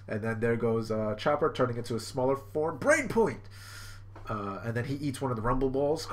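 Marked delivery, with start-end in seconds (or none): 2.8-3.4 high, punchy move name; pitched-up mock battle cry; attack call. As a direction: call out a transformation like an anime hero. Chopper calls out his move.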